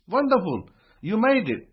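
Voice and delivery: ordinary delivery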